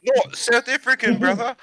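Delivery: high-pitched mock Maori accent; not South African. Shown as a demonstration. Setting a listener straight, high mock Maori accent. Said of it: "South African is like this".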